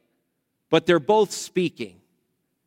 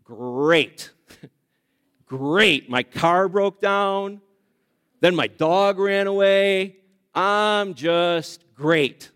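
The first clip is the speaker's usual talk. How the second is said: slow, dry sarcastic reply; sarcastic friend, slow and dry; slower than usual. Retold or imitated. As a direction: drag it out, meaning the opposite. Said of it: "say something like".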